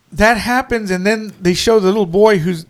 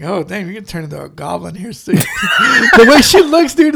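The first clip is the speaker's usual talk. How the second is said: laughing; 4 dB louder than usual. Retold or imitated. Retold reaction, laughing.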